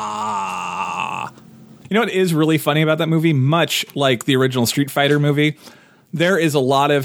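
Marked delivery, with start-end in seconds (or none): 0.1-1.3 bored groan. Acted. Groan at boring movie.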